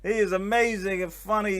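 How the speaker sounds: lightly imitated New York big talker; loud old-school guy. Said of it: "he's one of those loud, old school guys".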